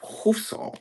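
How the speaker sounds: Housson breathed out in a high, airy French way; French accent. Shown as a demonstration. Greeting a viewer by name in French accent. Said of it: "call you with the French accent there".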